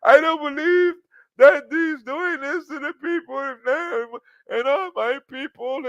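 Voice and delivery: high, loud, slow mock sobbing. Mocking a singer crying on camera about her people. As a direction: overdo the weepy outrage. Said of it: "she cried like a motherfucker".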